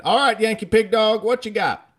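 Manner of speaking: very high, loud Southern drawl holler; insane Southern drawl. Retold or imitated. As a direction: bellow it as an exaggerated Southern professor. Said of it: "most insane drawl".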